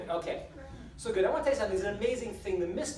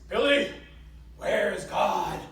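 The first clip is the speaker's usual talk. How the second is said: adamant preacher; 7 dB louder, much slower than usual. Acted. Preacher demanding an answer from little Billy, adamant. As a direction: boom it out like a preacher losing patience. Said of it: "the preacher gets adamant. He says".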